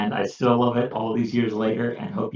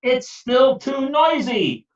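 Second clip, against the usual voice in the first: slow, higher complaining voice; Peter complaining; 12 semitones higher, slower than usual. Acted. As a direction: drag it out, fed up.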